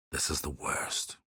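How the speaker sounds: soft, breathy whispered despair; quiet heartbroken whisper; hushed grief. Acted. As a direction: let it fall to a pained whisper. Grieving over kidnapped people.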